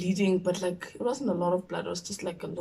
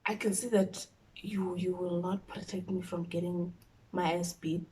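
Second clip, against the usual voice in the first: soft, pleading prayer under the breath; hushed prayer; 2 semitones lower, 9 dB quieter than usual. Retold or imitated. Praying alone before mom arrives, hushed and pleading. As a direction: pray quietly so mom won't hear.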